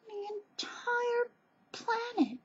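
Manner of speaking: very high, drawn-out squeal at normal volume; gushing squeak. Real, not acted. Vlogger gushing about cutest human, high slow squeak. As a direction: squeak it slowly, overcome by cuteness.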